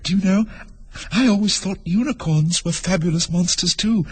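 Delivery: put-on high, light girl's voice with a smile; amused girl. A narrator's reading. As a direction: voice a little girl, smiling as she speaks. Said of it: "lips curling up into a smile".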